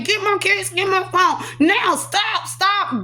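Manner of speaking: high, rapid-fire pleading; retold frantic demand. Patient grabbed by hospital staff, retold frantic demands. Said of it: "I'm going crazy".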